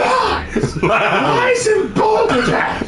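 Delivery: very high, loud, slow British bluster; gruff British outrage. Pretend. Mock-furious British gentleman shouting at a friend. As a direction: bellow it like an outraged old English gent. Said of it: "you gotta say it with British gruff".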